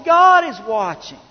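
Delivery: high, loud pulpit proclamation; emphatic preaching. Real, not acted. Preacher warning church that God is watching, emphatic. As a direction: proclaim it as the climax. Preacher's climax, then softer repeat.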